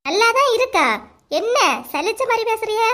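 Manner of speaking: high, disbelieving retort; offended challenge. Acted. Wife doubts husband's grudging compliment, offended high challenge. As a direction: echo his compliment back, piqued and high.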